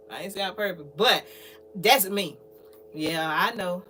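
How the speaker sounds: normal talking voice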